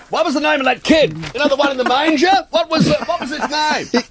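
high, flustered mock presenter voice; mock panicked presenter. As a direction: play a flustered host. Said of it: "they're desperately scrambling through their notes".

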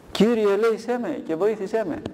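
high pleading cry to God; crying out loud. A narrator's reading. Dying rich man begs God for mercy, crying out. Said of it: "κράβγασε με μεγάλη φωνή".

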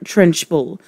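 usual speaking voice

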